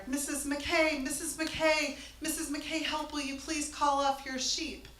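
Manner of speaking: loud calls from afar, pitch up; calling out for help. Retold or imitated. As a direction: shout for help from on top of a tank. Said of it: "calling from the yard".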